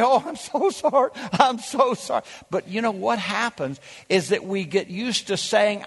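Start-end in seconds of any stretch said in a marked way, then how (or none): 0.0-2.2 higher-pitched, put-on show of remorse; hammed-up penitent pleading; mock apology. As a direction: act out an overdone "so sorry". Mimics a show of tearful repentance.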